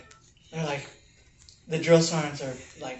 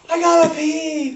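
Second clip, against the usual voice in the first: much higher, louder whiny yell; desperate kid shout; 13 semitones higher, 10 dB louder than usual. Retold or imitated. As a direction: wail it like a kid about to wet himself. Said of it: "He's like".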